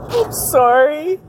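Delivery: apologizing through tears; tearful apology. Real, not acted. Apologizing to viewers for crying, tearful. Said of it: "I'm gonna cry".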